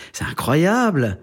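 high, slow, drawn-out astonishment; mimicked amazement. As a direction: play the astounded professor. Professor's amazement acted out.

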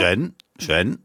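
"Gêne, gêne" said twice as a Chinese second tone; second-tone demonstration. Shown as a demonstration. Sinologist shows host how to pronounce it, second-tone demonstration. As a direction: model "Gêne" in second tone, each one set apart. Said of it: "Au deuxième ton, ça sera encore mieux".

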